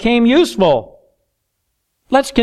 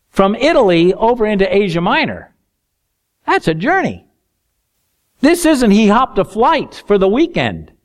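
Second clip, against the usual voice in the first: ordinary talk, nothing marked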